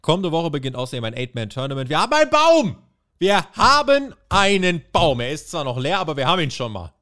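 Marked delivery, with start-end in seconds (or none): 1.9-2.8 high, loud proud announcement; triumphant cry; excited shout. Real, not acted. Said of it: "excited announcement of bracket". 3.2-5.2 slow, high, loud mock proclamation; solemnly mock pronouncement of "Wir haben einen Baum". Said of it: "repeated slower mock solemn".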